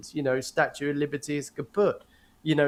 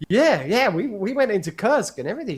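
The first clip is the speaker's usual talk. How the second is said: lightly higher, eager imitated answer; imitating his own excited reply about Kursk; eager retelling; 6 semitones higher than usual. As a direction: bounce through it like sharing big news. Mimicking own reply, laughing.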